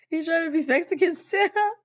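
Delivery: laughing at the memory; amused. Real, not acted. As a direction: barely hold in the giggles. Laughing about costume.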